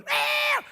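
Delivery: very high, loud squeal; party-girl whoop. Acted. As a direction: shriek a whoop like a drunk girl. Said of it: "looking for a girl who's going".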